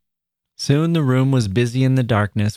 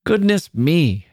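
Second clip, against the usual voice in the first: slow, higher cry of relief; relieved exclamation; 7 semitones higher, slower than usual. A narrator's reading. Mother finding it is only a flower, relieved.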